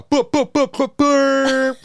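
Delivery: high, loud "put put" salute; drawn-out imitation gunfire, very high; mock gun-salute shots. Host saluting Jamaica, high loud shot noises.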